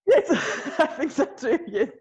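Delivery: laughing through the words. Real, not acted. Laughing as she agrees with the interviewer's joke. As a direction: chuckle while agreeing.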